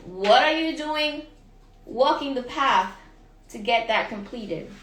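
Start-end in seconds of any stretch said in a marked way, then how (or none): none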